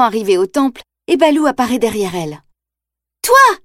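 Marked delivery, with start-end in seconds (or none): 3.2-3.6 high, loud cry of alarm; startled shout. Acted. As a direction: shout it as you raise a stick. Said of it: "s'exclame Shanti".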